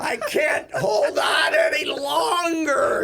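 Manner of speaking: strained, high, drawn-out mock scream; hammed-up agonized shriek; screaming for mercy. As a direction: wail it slowly like overstretched jeans begging. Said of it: "the jeans were screaming for mercy".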